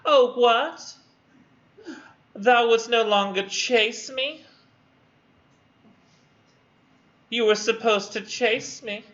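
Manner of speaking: high, slow put-on female voice; mock-offended woman. Student voicing the woman no longer chased, high mock offence. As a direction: play her put out, far above your own pitch. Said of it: "And she goes".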